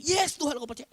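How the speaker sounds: high, loud yes Lord; hammed-up shouted faith; mock shouting believer. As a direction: yell it like a zealous congregant. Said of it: "jangan teriak-teriak".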